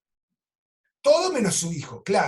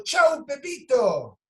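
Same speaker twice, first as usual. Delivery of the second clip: high, loud, long-drawn farewell shout; re-enacted sing-out goodbye, stretched and high; playful called-out goodbye; 7 semitones higher, 5 dB louder, much slower than usual. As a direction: call out a drawn-out goodbye in play. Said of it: "yo le digo".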